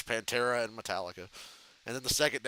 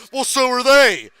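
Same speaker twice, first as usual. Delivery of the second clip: much louder, higher mock retort; retorting drummer; 10 semitones higher, 15 dB louder, slower than usual. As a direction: shout it back at the manager. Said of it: "And Peter".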